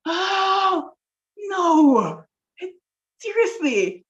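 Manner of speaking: high, loud, drawn-out mock wail; exasperated dismay. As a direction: wail in hammed-up despair. Dismay at learning he's a student.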